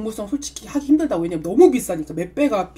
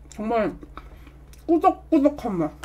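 talking while chewing cake; muffled through a mouthful; mouth full, chewing. Real, not acted. Seen 2: takes cake bite at -2, chews with lips pursed while speaking.

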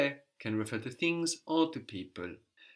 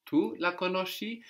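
"tu" stressed at the start, higher; emphatic tu; 7 semitones higher than usual. Shown as a demonstration. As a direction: lean on "tu" to insist. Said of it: "What if we wanted to be emphatic?".

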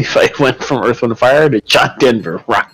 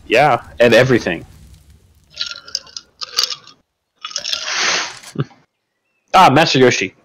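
talking around ice; words round a mouthful of ice; 3 semitones higher than usual. Real, not acted. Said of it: "I have ice in my mouth".

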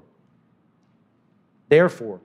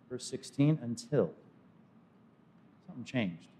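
"Verse sixteen, Until" dropped to a near hush, much softer; dramatic hush; 4 semitones lower, 14 dB quieter than usual. Acted. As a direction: let "Until" fall away softly to draw them in. Preacher builds to the key word.

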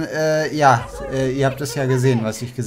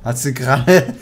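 laughing; 2 semitones higher than usual. Real, not acted. Laughing at game mishap.

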